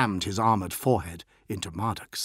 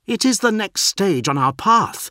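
much higher, louder defiant voice; forceful interruption; 12 semitones higher, 8 dB louder than usual. A narrator's reading. Postulant cuts off his angry captain, defiant. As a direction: cut in hard, high and loud. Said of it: "Marduk interrupted him".

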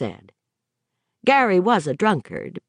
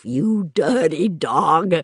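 gruff teasing drawl; slower than usual. Acted. Gary teasing his friend.

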